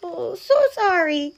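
high, small-creature apology; high-voiced polite condolence; sympathetic mouse. A narrator's reading. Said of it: "said the mouse".

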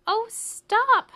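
high, loud desperate cry. A narrator's reading. Mouse cries to ten crickets to stop, desperate. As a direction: yell it out at the end of your patience. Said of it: "cried the mouse".

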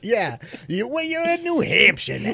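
put-on high, brash New York accent; tough-guy New Yorker, hammed up. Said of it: "Why are we doing New York accents?".

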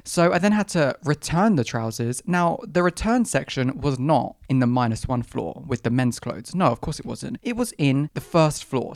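usual speaking voice

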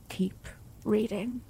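slow, hushed Keep reading; slow soft urging. Acted. Lover urging her on.

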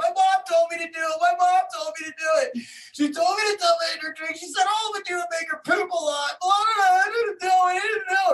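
high-pitched wailing imitation of a child's confession; imitated screaming. Cornered child blames mom to scolding parent, imitated screaming. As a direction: mimic a kid screaming excuses in a rush of panic. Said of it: "starts screaming".

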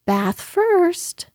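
high, cheery motherly call; cheerful. A narrator's reading. Kanga announces bath time to Piglet, cheerful. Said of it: "in a cheerful voice".

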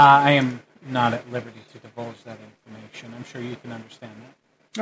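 hushed, guarded polite refusal; discreet calm guard. Acted. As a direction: decline softly, giving nothing away. Game master plays the calmer guard.

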